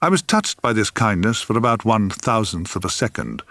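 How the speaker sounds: plain reading voice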